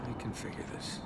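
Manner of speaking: breathy muttered aside under his breath; unvoiced self-talk whisper; whispered to himself. Real, not acted. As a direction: mutter it to yourself, barely voiced.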